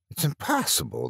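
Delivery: high, hushed protest; incredulous objection, kept soft. A narrator's reading. Startled passenger protests to officer.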